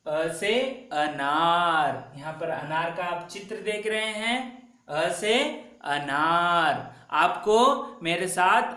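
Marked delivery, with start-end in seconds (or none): 0.9-2.1 अनार stretched very slowly, each sound drawn out; slow model word. Shown as a demonstration. Teacher drawing out अनार for pupils to echo. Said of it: "आपको भी उच्चारण करना है". 5.9-6.9 अनार stretched very slowly, each sound drawn out; slow model word. Shown as a demonstration. Teacher drawing out अनार for pupils to echo. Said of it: "आपको भी उच्चारण करना है".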